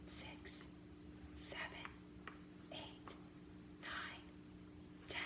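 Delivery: counting in a whisper; breathy whispered count. Shown as a demonstration. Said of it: "and whisper count as you work".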